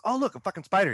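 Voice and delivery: high, loud retold discovery; imitated passer-by piping up; casual exclamation. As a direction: call it out on spotting it. Acts out passer-by spotting spider.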